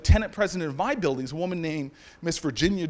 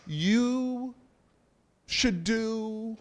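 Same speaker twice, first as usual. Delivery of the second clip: higher, stretched words with long pauses; portentous slow pronouncement; 4 semitones higher, much slower than usual. Acted. Miss Jones as vessel of the Lord, portentous and slow. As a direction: draw each word out like an oracle. Drawn-out pronouncement, as if God speaks.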